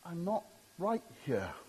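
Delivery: very soft, halting confession; hum, then admit it very quietly; humble admission. Acted. Preacher voices a man honest with God, quietly admitting fault. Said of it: "That's a guy who says".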